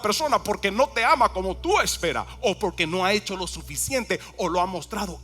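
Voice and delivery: high, loud and urgent pulpit delivery; fervent preaching. Acted. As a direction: press the point hard on the congregation. Preaching climax, raised voice.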